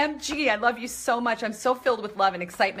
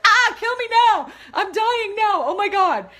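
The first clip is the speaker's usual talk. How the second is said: giddy excitement; 10 semitones higher, 5 dB louder than usual. Real, not acted. Overwhelmed by viewers' heart bombs.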